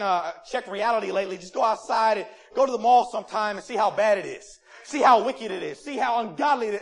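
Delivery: ordinary talk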